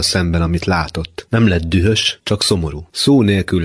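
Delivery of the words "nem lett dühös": ordinary delivery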